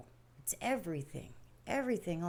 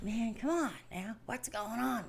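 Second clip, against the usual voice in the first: exasperated coaxing; 4 semitones higher, 3 dB quieter than usual. Retold or imitated. Wife retold pressing her sick husband.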